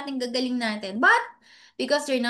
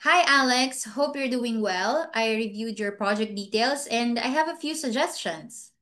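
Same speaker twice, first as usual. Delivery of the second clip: usual speaking voice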